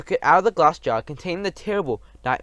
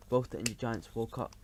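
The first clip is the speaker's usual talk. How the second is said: usual speaking voice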